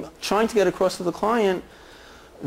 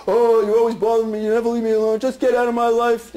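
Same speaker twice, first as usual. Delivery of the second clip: mock teen retort; 4 semitones higher than usual. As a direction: play the sulky kid snapping back at mom. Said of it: "What you're gonna say is what you always say".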